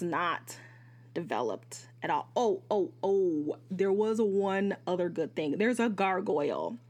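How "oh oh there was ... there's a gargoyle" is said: ordinary talk, nothing marked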